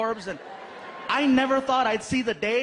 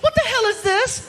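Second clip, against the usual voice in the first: put-on much higher, louder girlfriend squeal; shocked girlfriend; 13 semitones higher, 7 dB louder than usual. Girlfriend in the kitchen at night, shrieking much higher and louder. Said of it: "she's like".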